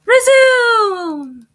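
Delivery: high, loud, drawn-out "Resume"; loud command. Pretend. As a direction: call it out long, falling from a high pitch. Calling out a command to the game.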